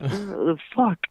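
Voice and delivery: high muffled grumble, imitated; muffled cursing. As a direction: imitate someone cursing, heard faintly through glass. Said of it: "kinda, like, these muffled".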